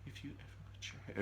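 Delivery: fast whispered menace; crazy voice. Retold or imitated. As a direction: hiss it fast like a madwoman. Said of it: "In her crazy voice".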